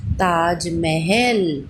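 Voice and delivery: Taj Mahal stretched very slowly, syllable by syllable; slowly sounded word. Shown as a demonstration. Teacher to children, drawing out Taj Mahal very slowly. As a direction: draw out Taj Mahal slowly so children hear each part. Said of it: "तो ये क्या बन गया हमारा".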